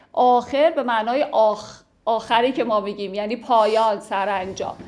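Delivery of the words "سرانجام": normal talking voice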